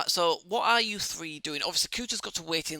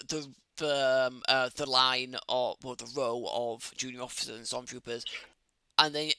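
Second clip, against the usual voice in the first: usual speaking voice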